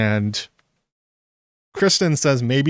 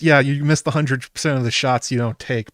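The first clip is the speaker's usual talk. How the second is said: ordinary talk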